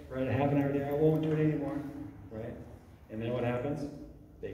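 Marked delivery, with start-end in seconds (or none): 0.3-1.8 higher mock plea, pushed a little; insistent mock bargaining. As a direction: imitate a child haggling for screen time. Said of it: "bargain with you".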